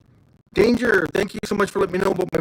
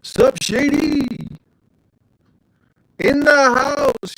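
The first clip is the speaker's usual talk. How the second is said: hype call; 8 semitones higher, 4 dB louder, much slower than usual. Acted. Hyping a viewer's arrival.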